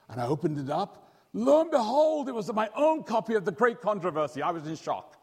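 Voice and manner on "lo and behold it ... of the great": much higher and louder burst of delight; excited reveal. Retold or imitated. Boy unwrapping his birthday present, higher and louder with delight. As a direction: announce the surprise like a thrilled kid. Story climax, opening the gift.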